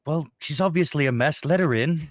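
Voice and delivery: lightly voiced impatient woman; a hint of the annoyed woman; the woman's voice. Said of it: "that same female voice".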